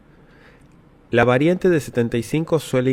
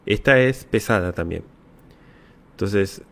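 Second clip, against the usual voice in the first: ordinary talk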